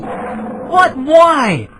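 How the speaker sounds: high, loud, slow panicked protest; frightened cry. Acted. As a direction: cry out in disbelief. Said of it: "cried the traveler".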